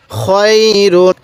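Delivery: খয়রুন with the "khoy" glide held long; drawn-out recitation. Shown as a demonstration. Teacher modelling the word, খয়রুন slow and drawn out. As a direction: stretch খয় slowly, then close on রুন. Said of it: "কীভাবে পড়ব?".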